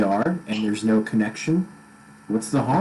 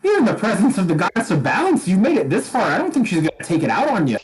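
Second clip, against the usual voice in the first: put-on Scottish accent; 7 semitones higher, faster than usual. GM voices Moradin reassuring.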